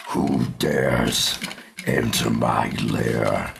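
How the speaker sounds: very deep monster voice, slow; growling dragon guarding treasure. Acted. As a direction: boom it out like a dragon. Dragon boss greets the party.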